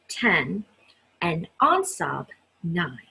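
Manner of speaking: ordinary delivery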